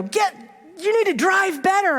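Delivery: high-pitched mock shout at a driver; retold yell. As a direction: yell it like an imitated irate motorist. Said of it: "he yells at him and says".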